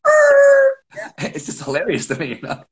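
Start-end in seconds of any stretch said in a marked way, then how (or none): 0.0-0.8 very high loud er squeal; a whole group's goofy squeal. Retold or imitated. Sixty kids squealing back at the coach. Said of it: "they all go".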